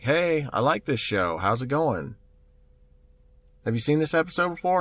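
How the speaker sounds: a hacker chatting casually through the camera; creepy friendly intruder. Retold or imitated. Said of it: "the guy's like".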